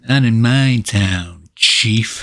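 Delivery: slow, drawn-out action one-liner. Acted. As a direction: drag it out like a cheesy hero. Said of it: "when they're about to do something".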